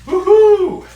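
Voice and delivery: loud, high whoop of delight; cheer. Real, not acted. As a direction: whoop it out, thrilled at the food. Cheering as food comes out.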